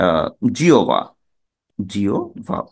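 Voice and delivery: জিওবা then জিওভা, o and b or bh instead of u and v; wrong pronunciation. Shown as a demonstration. Teacher showing students another common mistake. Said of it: "কিন্তু এটি ভুল".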